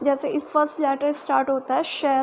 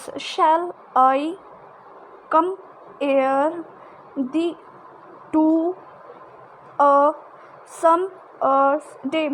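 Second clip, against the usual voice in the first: "Shall I compare thee to a summer's day" word by word, stressed words louder; slow word-by-word reading, stressed words punched louder; iambic stress; 3 dB louder, much slower than usual. Shown as a demonstration. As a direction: beat out the iambs word by word. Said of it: "Another way of showing this".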